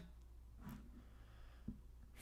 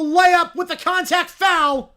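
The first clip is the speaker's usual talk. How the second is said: loud, slow, fired-up call of the foul; excited shout; 6 semitones higher, 8 dB louder, slower than usual. Real, not acted. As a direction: belt out the play like a live announcer.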